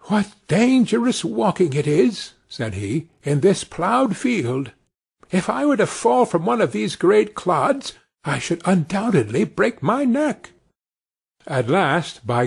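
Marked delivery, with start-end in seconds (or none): 0.1-2.3 put-on high, small voice of a thumb-sized boy; lightly voiced little Tom, fretting; tiny Tom voice. Tiny Tom frets aloud to himself about the dangerous field, in a lightly voiced little boy's tone. 3.2-10.5 put-on high, small voice of a thumb-sized boy; lightly voiced little Tom, fretting; tiny Tom voice. Tiny Tom frets aloud to himself about the dangerous field, in a lightly voiced little boy's tone.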